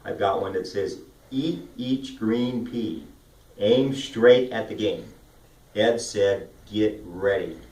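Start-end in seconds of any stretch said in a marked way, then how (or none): 1.3-7.6 "Eat each green pea" slow, every word drawn out; vocal warm-up drill. Shown as a demonstration. Coach reading from a warm-up sheet, "Eat each green pea" slow and deliberate. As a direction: stretch and separate each word from "Eat" to "ready". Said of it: "sheet that calls vocal warm-ups".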